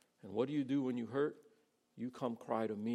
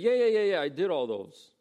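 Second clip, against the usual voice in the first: breezy, high, loud brush-off; mock dismissive; 5 semitones higher, 8 dB louder than usual. Rich young ruler answering Jesus, mock dismissive. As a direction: toss it off like it's nothing.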